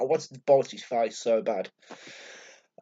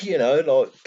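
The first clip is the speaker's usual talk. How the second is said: normal talking voice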